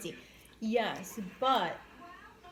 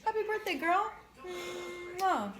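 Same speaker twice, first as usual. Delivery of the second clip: excited birthday greeting; 10 semitones higher, 5 dB louder than usual. Real, not acted. Birthday shout-out to a friend.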